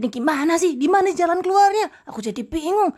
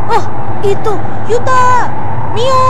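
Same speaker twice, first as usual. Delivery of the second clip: loud, urgent shout to companions; alarmed calling out; 3 semitones higher, 12 dB louder than usual. Acted. Ghost hunter calls out to two companions, alarmed shout. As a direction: call their names at full volume.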